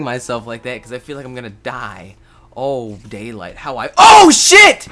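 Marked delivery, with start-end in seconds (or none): 3.6-4.9 very high loud shriek; real shocked yelp; startled scream. Real, not acted. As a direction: panic at the sudden hit. Sudden danger in game.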